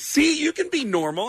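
high, drawn-up retold exclamation; teasing approval. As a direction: needle a friend in a high voice. Star teasing him, retold.

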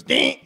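sharp, loud grinding "ding"; imitated starter noise. Comic mimicking an already started car, sharp noise. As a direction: bark out a car starter's jolt. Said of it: "and it goes".

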